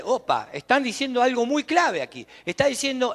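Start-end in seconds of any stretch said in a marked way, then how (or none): none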